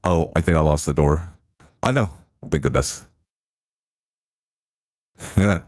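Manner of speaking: ordinary talk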